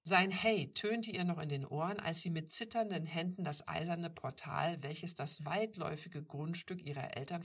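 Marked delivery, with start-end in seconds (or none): none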